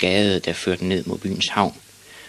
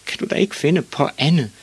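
high, exasperated mother's voice; exasperated higher-pitched complaint; sighing mother; 9 semitones higher than usual. A narrator's reading. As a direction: plead with a sigh, pitched high. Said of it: "sukkede hans mor".

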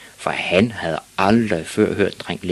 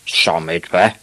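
disbelieving dad question; incredulous father; 5 dB louder than usual. Acted. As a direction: echo it as a baffled father. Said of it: "sagde hans far".

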